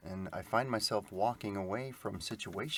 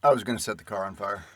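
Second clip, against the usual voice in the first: fast, loud exasperated burst; loud outburst; 12 dB louder, much faster than usual. Real, not acted. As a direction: blurt it out fed up. Angry joke about the broken car.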